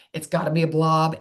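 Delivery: ordinary talk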